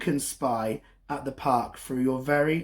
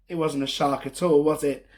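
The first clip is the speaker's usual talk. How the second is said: ordinary delivery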